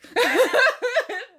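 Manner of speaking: sudden high cackle; high laugh. Real, not acted. After the neighbor joke, high cackling laugh. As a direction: burst out laughing.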